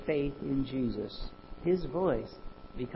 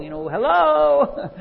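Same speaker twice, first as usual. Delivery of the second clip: much higher, louder and slow, mock disbelief; exasperated hello; 11 semitones higher, 9 dB louder than usual. Real, not acted. Preacher mocking critics who missed the miracle, exasperated and loud. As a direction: throw it out like a wake-up call.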